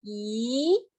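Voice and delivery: Y gliding upward; second tone. Shown as a demonstration. Teacher showing second tone, Y rising. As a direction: lift "Y" steadily like a question. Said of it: "para arriba".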